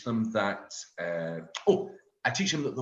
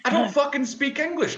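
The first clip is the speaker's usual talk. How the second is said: laughing outburst; 6 dB louder than usual. Real, not acted. Laughing confession to crowd.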